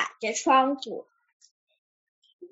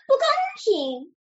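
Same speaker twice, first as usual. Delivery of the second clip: high-pitched indignant cry; loud protest; 10 semitones higher than usual. A narrator's reading. Duck loses the chair, high indignant protest. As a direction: protest sharply in a much higher pitch. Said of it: "他大声叫道".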